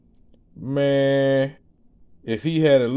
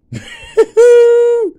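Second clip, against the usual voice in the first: loud shrieking laugh; 15 dB louder than usual. Real, not acted. Cracking up over quicksand memory.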